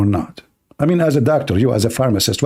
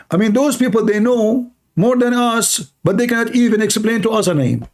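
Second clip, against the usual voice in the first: heated; 6 semitones higher than usual. Real, not acted. Heated argument, raised pitch.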